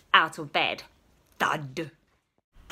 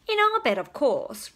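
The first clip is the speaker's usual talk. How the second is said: higher motherly voice; 6 semitones higher than usual. Acted. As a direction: answer sweetly as mother. Mother replies.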